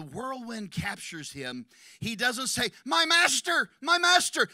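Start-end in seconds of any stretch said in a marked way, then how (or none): 2.8-4.5 loud anguished cry, high; a pleading, high cry. Acted. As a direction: cry out to him from the heart. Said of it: "Listen to the warmth in his voice when he cries out".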